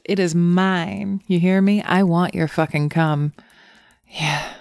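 ordinary talk

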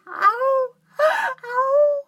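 sharp high cries of pain. Acted. Crying out in pain.

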